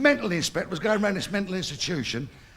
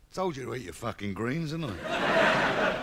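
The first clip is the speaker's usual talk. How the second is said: ordinary talk, nothing marked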